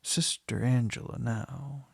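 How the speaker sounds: plain reading voice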